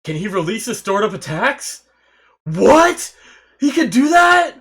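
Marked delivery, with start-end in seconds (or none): 2.4-4.5 high, loud disbelieving yell; astonished shout. Real, not acted. Viewer stunned by a hero's hidden power, astonished shout. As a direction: shout it in real shock. Stunned reaction to a show twist.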